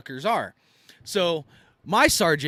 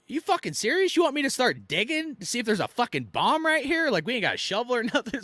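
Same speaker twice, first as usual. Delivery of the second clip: incredulous; 7 semitones higher than usual. Retold or imitated. Incredulous at sergeant's order.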